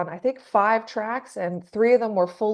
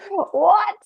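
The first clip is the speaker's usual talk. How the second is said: high, loud shocked "What?"; 12 semitones higher, 6 dB louder than usual. Retold or imitated. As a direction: gasp out the word in disbelief. Retold shock at singer's voice.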